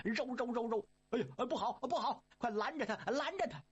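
high, urgent cries to stop him; heavenly gods crying to block the monkey; alarmed gods shouting. Acted.

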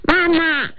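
高而稚嫩的童声; 像饿了的小孩喊妈妈; 小孩儿声音. Shown as a demonstration. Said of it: "那小孩儿呢". (学小孩撒娇喊饿)

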